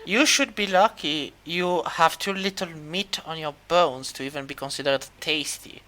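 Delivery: quietly disdainful Sphinx. Acted. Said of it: "The Sphinx looks at you and goes".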